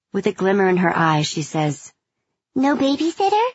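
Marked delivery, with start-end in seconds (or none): 2.6-3.5 put-on high, eager child voice; a hopeful little kid asking; little girl voice. A narrator's reading.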